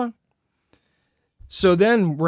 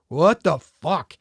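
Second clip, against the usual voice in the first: stunned; much slower than usual. Retold or imitated. His stunned reaction.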